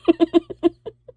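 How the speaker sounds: loud high laugh; high burst of laughter. Real, not acted. Laughing loudly to viewers at her own excuse. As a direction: let a real laugh burst out.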